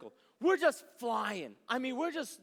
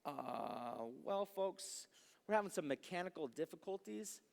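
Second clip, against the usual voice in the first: softer, lower captain voice; lightly voiced captain breaking bad news; pilot announcement; 6 semitones lower, 9 dB quieter than usual. Captain telling passengers the door is stuck, soft announcement.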